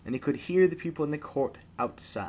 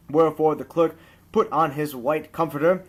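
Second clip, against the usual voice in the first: ordinary delivery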